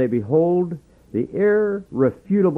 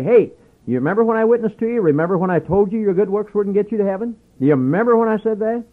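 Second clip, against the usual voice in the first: higher, fast reproach; told-you-so; 6 semitones higher, faster than usual. Pretend. Preacher imagines confronting a lost friend, higher and fast. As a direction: remind him he was warned, quickly. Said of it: "I wanna walk up to him and say".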